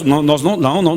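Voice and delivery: usual speaking voice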